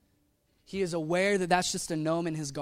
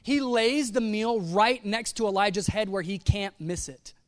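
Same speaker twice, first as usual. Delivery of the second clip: ordinary talk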